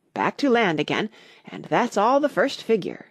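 usual speaking voice

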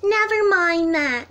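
a lightly played cheeky duck. Duck and bunny voiced in story.

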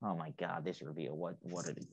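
very low and soft, under the breath; dismayed mutter. Retold or imitated. Recalling watching the car reveal alone, muttered dismay. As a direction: mumble the recalled reaction, barely voiced.

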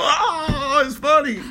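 high, loud, laughing through the words; mock drunk laughter. Mocking how drunks laugh at bad jokes, high and giggly. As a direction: ham up a tipsy giggle at a bad joke. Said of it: "you, you drunk".

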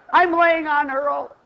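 ordinary delivery

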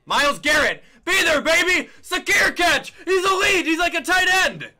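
loud, high celebratory yelling; triumphant screaming; excited hype shout. Real, not acted. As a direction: scream praise for your player. Celebrating catch.

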